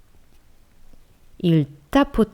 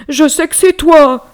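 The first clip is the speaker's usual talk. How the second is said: higher, loud insisting; insistent bear; 10 semitones higher, 10 dB louder than usual. Acted. Frightened bear insisting it's the mouse, loud. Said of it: "insista-t-il".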